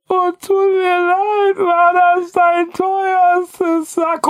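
squeaky mock-sorry kid. Child voiced apologising after vomiting.

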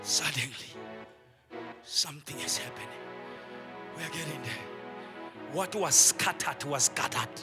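soft, slow near-whisper; hushed preaching. Real, not acted. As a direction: drop to a hush. Sudden hush after shouting.

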